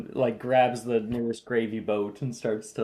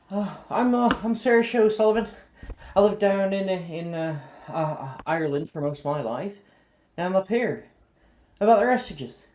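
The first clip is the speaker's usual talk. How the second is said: put-on high, lively Irish girl; chirpy young Irish introduction; 10 semitones higher, 7 dB louder than usual. Saoirse introduces herself.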